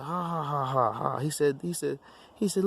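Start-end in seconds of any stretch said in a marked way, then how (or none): 0.0-1.2 spaced-out mocking ha ha ha, higher; mock demon laughter. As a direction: laugh at him like a cruel tormentor. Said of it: "they laugh and said".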